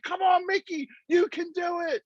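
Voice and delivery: high, strained cheering call; mock coaching holler; coach's encouragement. Retold coaching, calling out to rider.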